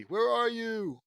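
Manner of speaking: high, loud drawn-out call; long searching shout; yelling out. A narrator's reading. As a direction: holler it slowly across a distance. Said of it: "yell out".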